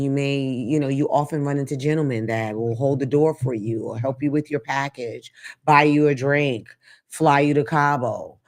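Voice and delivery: normal talking voice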